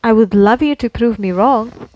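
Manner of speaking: ordinary delivery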